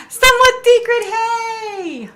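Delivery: very high, sing-out hello; excited greeting. Real, not acted. Streamer greets a viewer not seen in forever, excited. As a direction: call it out high, stretching the hey.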